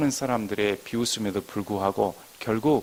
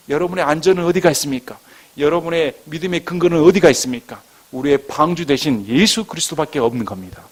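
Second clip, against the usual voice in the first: raised, louder pulpit voice; insistent preaching; 5 semitones higher, 8 dB louder than usual. Real, not acted. Pastor asking the congregation where their safety lies, preaching insistently. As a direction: press the questions on the congregation.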